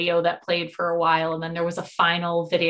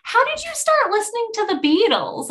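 high-pitched retold eager question; 3 dB louder than usual. Concertgoer quizzing a fellow fan, eagerly imitated. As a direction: imitate an eager, chatty stranger.

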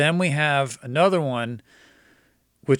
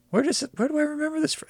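puzzled surprise; much faster than usual. Retold or imitated. Puzzled recognition at familiar exit.

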